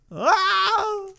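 high-pitched cry of frustration; frustrated squeal. Real, not acted. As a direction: squeal out at failing the level. Failing the level.

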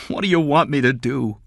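high-pitched desperate appeal; desperate pleading. A narrator's reading. Father asks what employer wants of him, desperate pleading.

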